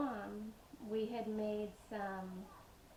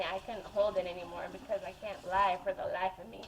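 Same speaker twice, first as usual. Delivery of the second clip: ordinary delivery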